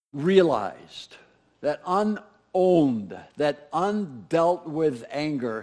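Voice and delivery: slow, weighed words; slow, deliberate. Acted. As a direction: lean on each word slowly. Dwelling on each word.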